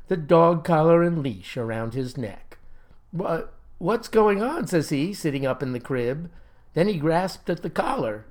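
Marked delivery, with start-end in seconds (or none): 3.1-4.7 higher, slow stuttered waking question; startled bewilderment. A narrator's reading. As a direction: come to confused, stumbling on the first word. Boy wakes up leashed, bewildered.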